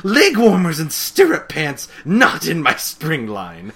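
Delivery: loud mock indignation, high start; hammed-up disgust at bad fashion; slow down on the final refusal; outraged fashionista. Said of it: "And then she goes".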